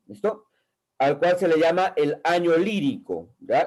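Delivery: normal talking voice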